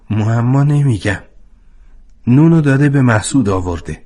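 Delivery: plain reading voice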